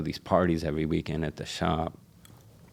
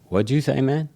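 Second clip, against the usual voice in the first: incredulous; 8 semitones higher, 4 dB louder than usual. Retold or imitated. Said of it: "And I'm like".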